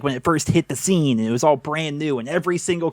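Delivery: usual speaking voice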